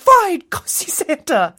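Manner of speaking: high, loud mock-exasperated mother; exasperated giving in. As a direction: give in to a screaming kid, loudly. Mother gives in to tantrum.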